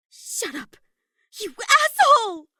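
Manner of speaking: put-on girl screaming insults in rage; furious shout. A narrator's reading. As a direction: scream it at him, losing control. Maki explodes at Kokichi.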